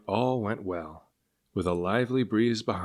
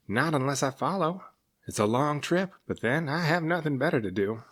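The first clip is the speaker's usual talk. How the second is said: higher, resigned serpent voice; higher, easygoing sea serpent; musing serpent; 7 semitones higher than usual. Acted. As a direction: talk yourself into following. Cyrus musing.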